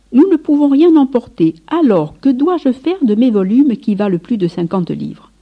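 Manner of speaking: loud, high, clarion-voiced question; ringing voice. A narrator's reading. A minister challenges François about his costly books, ringing voice. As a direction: ring it out like a trumpet. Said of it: "d'une voix claironnante".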